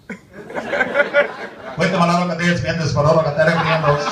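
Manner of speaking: loud, rapid-sounding mock Spanish; talking to kitchen guys. Comedian hailing the kitchen guys, loud mock Spanish. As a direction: call it out in put-on Spanish. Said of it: "Let me talk to the guys in the kitchen".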